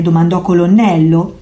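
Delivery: plain reading voice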